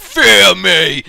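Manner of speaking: loud commanding fear me; villain roar. Pretend. Boss opening the meeting, a loud villain roar. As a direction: bellow it to scare them. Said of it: "a great impressive".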